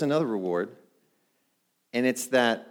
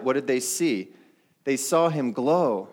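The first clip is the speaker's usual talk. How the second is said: ordinary delivery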